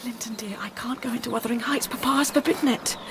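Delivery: soft unvoiced whisper. Catherine to Linton, hushed whisper. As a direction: keep it between the two of them.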